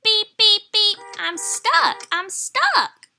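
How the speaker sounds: high, loud anxious beeps; worried beeping. A narrator's reading. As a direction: plead that you're stuck. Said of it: "cried the Little Blue Truck".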